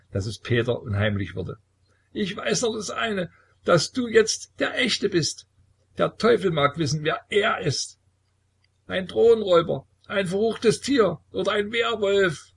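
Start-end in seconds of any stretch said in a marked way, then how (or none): 2.1-12.5 sehr hoch, düster lallend; betrunken. A narrator's reading. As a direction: betrunkenes Raunen über den Teufel. Betrunkener Sohn raunt düster. (sehr hohe, unheimliche Säuferstimme)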